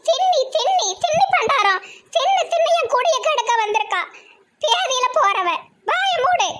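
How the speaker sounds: high, nagging granny voice cursing a glutton; shrill-free high scold of a cranky grandma; scolding old woman. Acted. Old granny scolds the girl for eating, high nagging scold.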